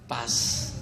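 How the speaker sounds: very low, barely voiced sulky reply. Sulking church member returning a greeting, sulky. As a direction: mutter the greeting back, acting grumpy. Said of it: "A pessoa tá lá emburrada".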